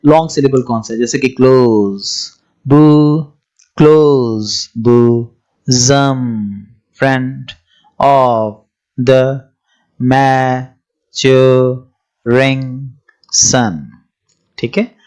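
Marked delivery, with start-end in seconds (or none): 1.3-3.2 "close, बू" drawn out, each syllable held apart. Shown as a demonstration. Teacher starts splitting a verse line for students, syllable by syllable. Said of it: "one by one देखते हैं". 3.8-13.7 "close, बू, जम, friend of the maturing sun" very slow, a pause after every syllable. Shown as a demonstration. Teacher scanning a verse for long and short syllables, syllable by syllable. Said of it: "one by one देखते हैं".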